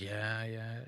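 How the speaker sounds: soft, offhand yeah; barely there, half-listening reply; distracted mumble. Alec answering his wife on the phone, soft and absent. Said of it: "he's on the phone like".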